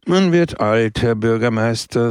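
ordinary delivery, nothing marked

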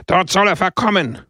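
mock crowd yell; jeering shout. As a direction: jeer from the crowd. Assembly jeering.

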